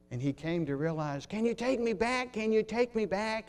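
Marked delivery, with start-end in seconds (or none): 1.3-3.5 high, urgent pleading; George Bailey pleading. Acted. George Bailey begging to have his life back, pleading.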